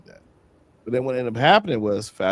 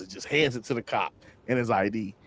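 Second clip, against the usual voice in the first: ordinary delivery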